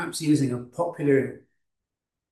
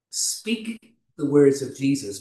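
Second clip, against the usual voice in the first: ordinary talk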